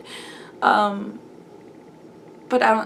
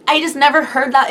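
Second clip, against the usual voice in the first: ordinary talk, nothing marked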